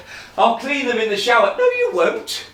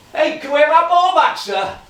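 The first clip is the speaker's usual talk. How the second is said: higher, louder shout across; calling out; 10 semitones higher, 7 dB louder than usual. Acted. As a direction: shout over the fence for the ball. Boy calling from the street.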